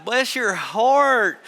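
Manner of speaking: slow, drawn-out Southern "Bless your heart"; imitating a Southern drawl, slow and sweet. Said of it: "That's how they say it in the South".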